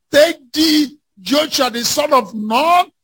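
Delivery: ordinary delivery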